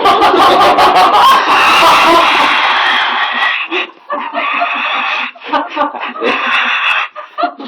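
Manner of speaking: very high, long unvoiced laugh, almost crying; long howling laughter. Real, not acted. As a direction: laugh until you nearly cry. Punchline about everybody leaving.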